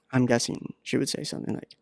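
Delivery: ordinary delivery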